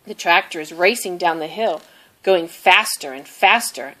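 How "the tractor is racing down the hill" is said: ordinary delivery, nothing marked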